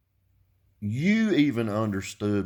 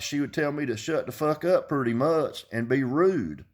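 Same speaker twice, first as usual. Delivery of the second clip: normal talking voice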